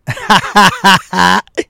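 a hiccup that breaks into a laugh; helpless laugh caught on a hiccup; hiccup giggles. Real, not acted. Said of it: "that was a crazy laugh hiccup".

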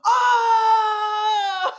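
high loud crowd "Oh!"; crowd's gasp. Retold or imitated. Imitating the crowd at McKellar Park, a loud high "Oh!". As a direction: belt it like a stadium crowd gasping. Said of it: "you could hear everyone go".

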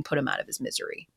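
quick, hushed mock plea; muttered aside. Hosts joking about a benched quarterback, hushed. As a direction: breathe it fast under the breath.

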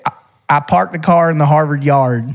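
mock Southern US accent on "I parked the car in the Harvard yard"; lightly drawled Southern US accent; playful Southern US accent. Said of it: "el sur dirían".

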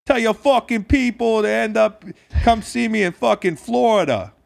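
put-on high, loud New England accent sliding into New York; hammed-up, badly faked New England accent. Host imitating a meme character, botched New England accent. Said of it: "being like a New, New York accent".

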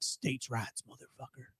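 low, very soft retold defiance; mock muttered defiance; low defiant mutter. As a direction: mutter it under your breath, trailing away. First encounter with the suspect.

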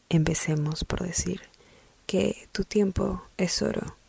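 hushed, slow whisper; soft whisper. Shown as a demonstration. Host opening her podcast topic, slow soft whisper. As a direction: breathe it like a secret. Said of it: "sí, lo dije así".

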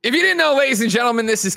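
big high host announce; announcer voice. Acted. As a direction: boom it out like a host.

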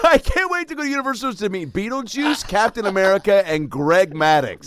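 put-on high, loud, thrilled child voice; giddy kid. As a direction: gush like a kid going to a theme park. Mocking excited theme-park kid.

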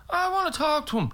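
high, drawn whine of demand; mock insistence. Dad demands to meet the singer, mock insistence. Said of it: "your father was insisting on".

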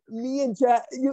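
normal talking voice

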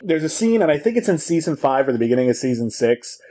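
ordinary talk

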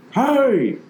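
loud high shout of hey; hollering hey. Retold or imitated. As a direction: holler it from up the bank. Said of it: "this guy's hollering".

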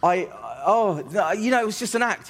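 stumble, then rush out an appeasing excuse; nervous placating. Retold or imitated. Young comic backing down to the threatening heckler, flustered.

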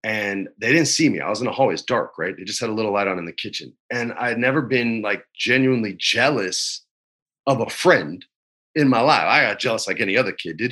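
normal talking voice

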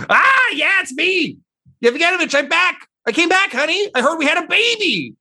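very high, excited squawking character voice; a high-pitched voice bellowing a homecoming; possessing figure's outburst. Acted. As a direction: burst out in a shrill, piercingly high cry. Possessing voice bursts from her mouth.

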